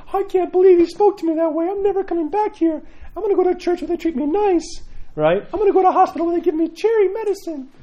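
whiny offended churchgoer. Retold or imitated. Priest mocks offended American churchgoers in a high whiny voice. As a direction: squeal it like a pampered complainer. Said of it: "they'd be like".

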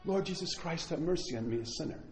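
very soft, prayerful murmur; quiet prayer. Shown as a demonstration. Priest showing the Jesus prayer, hushed and soft. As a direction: pray it under your breath.

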